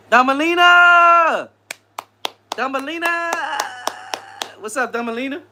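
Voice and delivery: playful high singing greeting; sing-song call. Pretend. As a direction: sing the name out across the room. Calling out a viewer's name.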